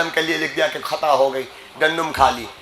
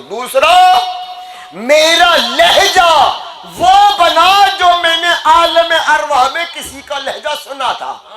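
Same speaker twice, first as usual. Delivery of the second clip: high, slow, sustained sermon climax; soaring, drawn-out preacher's shout; full-force preaching; 12 semitones higher, 4 dB louder, slower than usual. Real, not acted. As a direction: cry each phrase out to the whole crowd.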